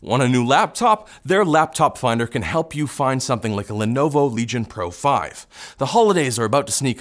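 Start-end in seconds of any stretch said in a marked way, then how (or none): none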